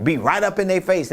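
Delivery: ordinary talk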